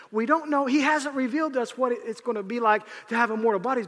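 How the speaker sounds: normal talking voice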